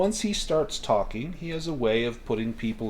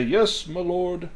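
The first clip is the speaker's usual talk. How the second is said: slow, higher distant servant reply; lightly voiced butler; 6 semitones higher, slower than usual. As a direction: answer the lord from another room. Servant answers from afar.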